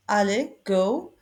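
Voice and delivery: ordinary delivery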